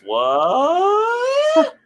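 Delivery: very high, loud, drawn-out shriek of disbelief; hammed-up shock sliding way up; mock surprise. Recapper playing Tony hearing of the betrayal, mock surprise. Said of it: "Surprise face".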